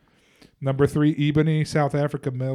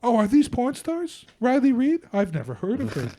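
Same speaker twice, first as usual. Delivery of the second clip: mock innocence; 8 semitones higher than usual. Mocking feigned innocence.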